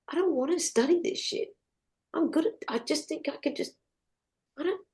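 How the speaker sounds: struggling sigh. Retold or imitated. Struggling, self-talk.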